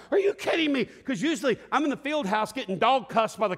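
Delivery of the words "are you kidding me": exasperated. Retold or imitated. Reacting to band marching.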